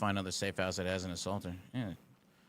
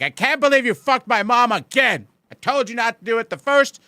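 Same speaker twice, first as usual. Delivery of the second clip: loud, high, hammed-up furious yell; mock upset yelling; 14 semitones higher, 14 dB louder than usual. Shown as a demonstration. Streamer demonstrating what upset sounds like, loud mock yell. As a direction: scream it like a betrayed partner. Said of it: "Upset would be like".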